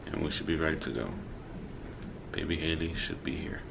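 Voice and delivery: quiet. Real, not acted. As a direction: keep it down so she can sleep. Talking softly while she sleeps.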